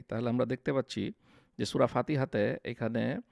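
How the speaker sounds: ordinary talk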